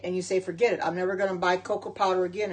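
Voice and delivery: ordinary delivery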